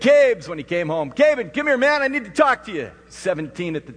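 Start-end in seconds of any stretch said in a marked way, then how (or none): 0.0-0.5 loud, high holler to his son; calling out. Retold or imitated. Calls his son on arriving home. 1.1-2.9 loud, high summons; calling out. Retold or imitated. Calls his son over to talk.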